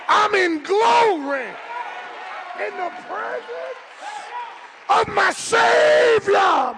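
rising shouted sermon climax, stretched and loud; full-throated preacher whoop; preaching at full force. Acted.

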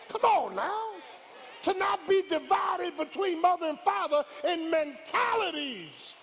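slow, high, shouted sermon climax; full-force preaching. Real, not acted. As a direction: preach it out loud to the congregation.

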